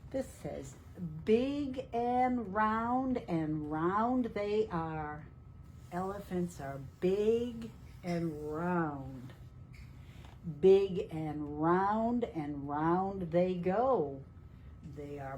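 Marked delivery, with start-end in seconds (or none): 1.2-5.2 slow sing-song reading of a rhyme; rhythmic read-aloud. Acted. Teacher reading the rhyme to children, slow and sing-song. Reading rhyming picture book text. 10.6-14.2 slow sing-song reading of a rhyme; rhythmic read-aloud. Acted. Teacher reading the rhyme to children, slow and sing-song. Reading rhyming picture book text.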